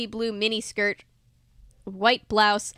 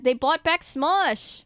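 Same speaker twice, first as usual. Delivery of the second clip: ordinary delivery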